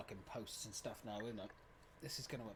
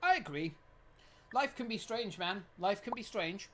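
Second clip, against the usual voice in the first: usual speaking voice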